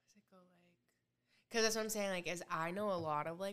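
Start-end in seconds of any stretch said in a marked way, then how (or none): 0.0-1.5 slow hushed whisper. Real, not acted. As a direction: whisper the question. Whispered question.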